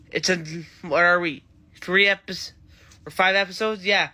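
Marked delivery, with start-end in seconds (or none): none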